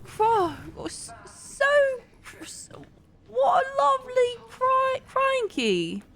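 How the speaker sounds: very high, halting mock Australian; attempted Australian accent. Shown as a demonstration. Streamer struggling through her Australian demo, high faltering Aussie accent. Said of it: "I can do an Australian accent".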